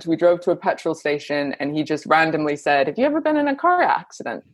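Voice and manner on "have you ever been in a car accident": son's voice. Retold or imitated. Son's question voiced in story.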